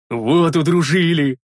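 slow, heartfelt delight; delighted bellow. A narrator's reading. Big man brandishing his axe, delighted. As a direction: crow with gratitude. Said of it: "Обрадованным медведем заревел Рикар".

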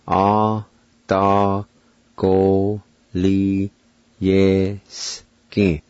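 A da go li ye ski split into separate syllables; one beat per syllable of A da go li ye ski. Shown as a demonstration. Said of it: "pronounce these words".

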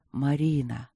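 low, drawn call of her name; lightly voiced breath of wind; wind's call. A narrator's reading. Wind calling to Marina again at night, low call. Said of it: "Выдох ветра".

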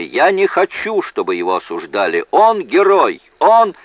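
high, loud defiant cry; shouted outburst. A narrator's reading. Witness defending the accused before the jury, shouting out. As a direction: shout it high, building to the last words. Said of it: "выкрикнул Луни".

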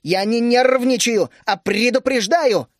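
loud, high, slow indignant outburst; flaring up. A narrator's reading. Teresa snapping back at her husband, indignant shout. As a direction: flare up and hit each word. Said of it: "взвилась Тереза".

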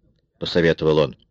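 ordinary delivery

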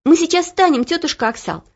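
put-on girl promising to get up; young girl Olya. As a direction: answer the cook as a drowsy young girl. Girl Olya voiced high.

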